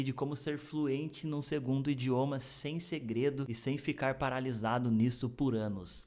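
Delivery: ordinary talk, nothing marked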